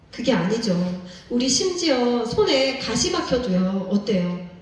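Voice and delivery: ordinary delivery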